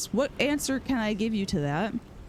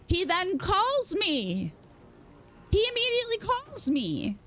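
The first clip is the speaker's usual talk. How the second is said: much higher, drawn-out exclamation; incredulous outrage; 10 semitones higher than usual. Real, not acted. Telling how dad immediately called her, incredulous. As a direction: stretch it out high in disbelief.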